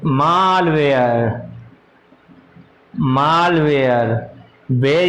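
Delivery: very slow, stretched-out syllables; drawn-out dictation. Real, not acted. Teacher giving the class a new term, drawn-out dictation. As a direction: stretch the term out for note-takers.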